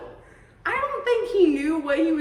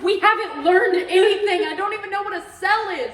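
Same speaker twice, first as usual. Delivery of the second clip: excited quote; 6 semitones higher, 7 dB louder than usual. Retold or imitated. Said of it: "I was like".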